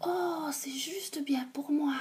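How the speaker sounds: soft unvoiced whisper to herself; whispered thought. A narrator's reading. Goldilocks whispering her thought to herself. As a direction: breathe it as a private sleepy thought. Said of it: "elle pense".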